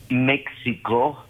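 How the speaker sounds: «México» con la X sonando ks, lento; X como ks. Shown as a demonstration. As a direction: say «México» as MEK-see-ko, slow and deliberate. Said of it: "jamás se puede pronunciar". («México» pronunciado con ks, despacio)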